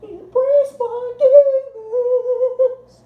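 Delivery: very high, very slow falsetto camp; hammed-up camp diva wail; over-the-top camp. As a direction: stretch it out in a soaring camp falsetto. Camp act, second take.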